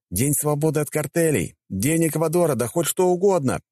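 high, shouted back over the crowd; shouting. A narrator's reading. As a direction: call out over a noisy crowd. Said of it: "прокричал ему ответ".

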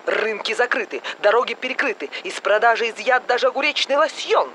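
brisk announcer read; news bulletin. Acted. TV newsreader announces the cucumber epidemic.

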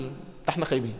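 soft, sinking little complaint; quiet mock grumble of self-pity. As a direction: sigh it like someone who feels hard done by. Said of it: "تبص على اللي اعلى منك تقول".

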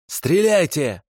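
высокий надрывный крик; кричит, подставляя грудь. Acted. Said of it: "крикнул он". (надрывно кричит «стреляйте»)

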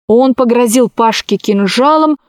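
plain reading voice

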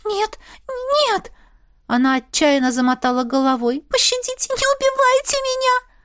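high, frightened begging; terrified pleading. A narrator's reading. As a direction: beg for mercy in a high voice. Frightened maid begs for her life.

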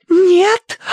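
high, loud shocked gasp; Tossie. A narrator's reading. Tossie hearing the butler's verdict, gasping shock. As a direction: gasp it out in squeaky disbelief. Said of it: "задохнулась Тосси".

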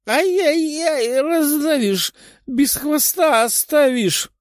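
very high, drawn-out squeal; pained wailing cry. A narrator's reading. Dasha's tail crushed by princess's thighs, panicked wail. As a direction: squeal in comic panic, stretching the words. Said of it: "кричала Даша".

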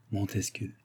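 ordinary delivery

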